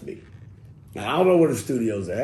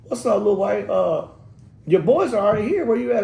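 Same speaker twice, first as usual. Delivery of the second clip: higher and louder laid-back greeting; casual producer; 9 semitones higher, 5 dB louder than usual. Retold or imitated. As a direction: hail him like an old friend. Voicing Paul on the phone.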